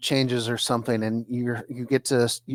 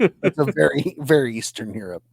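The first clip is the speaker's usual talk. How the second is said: mock Russian accent. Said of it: "I'm going to do a Russian accent".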